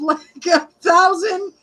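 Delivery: delighted burst with a laugh; laughing excitement. Real, not acted. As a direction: marvel at the number. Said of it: "excited about subscriber jump".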